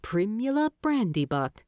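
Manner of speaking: plain reading voice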